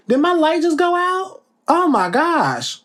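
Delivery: sudden high startled question. Real, not acted. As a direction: caught off guard by the dark. Light suddenly goes out.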